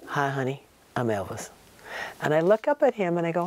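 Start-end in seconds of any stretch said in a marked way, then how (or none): 0.1-1.5 low, soft imitation of a man's greeting. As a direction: drop low and quiet, imitating his introduction. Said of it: "he puts his arm out to me and he goes".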